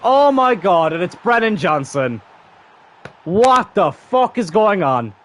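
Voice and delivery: high, loud, slow disbelieving yell; real disbelief at the scoreline; stunned shout. Real, not acted. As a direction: shout in shock at the chaos. Shock at a wild scoreline.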